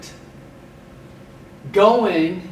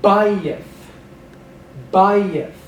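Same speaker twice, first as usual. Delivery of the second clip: Bayith, first syllable BA louder and stressed; 3 semitones higher, 6 dB louder than usual. Shown as a demonstration. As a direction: hit BA in Bayith. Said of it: "penultimately stressed".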